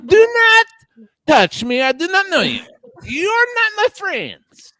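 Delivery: high, fussy diva cat who won't be touched; Mary Cheese cat voice. Acted. Owner voicing her cat to her friends, high and haughty. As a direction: play the haughty cat snubbing strangers. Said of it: "she talks like this, as Mary Cheese, as Kitty Kate".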